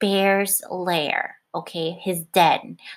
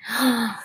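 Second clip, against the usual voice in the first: mock gasp; 2 semitones higher than usual. Bear angry in story.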